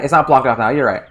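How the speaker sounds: ordinary talk, nothing marked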